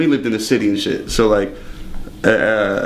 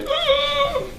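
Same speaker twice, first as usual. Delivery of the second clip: sharp, very high gasp; shocked gasp; 5 dB quieter than usual. Retold or imitated. Staff seeing the bone, high horrified gasp.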